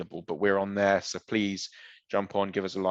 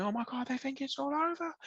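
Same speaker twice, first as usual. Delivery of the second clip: high-pitched, restrained mock scream; 5 dB quieter than usual. Host showing what the commentator didn't do, mock screaming. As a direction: fake an excited commentator's scream without real volume. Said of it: "It's not like he's screaming".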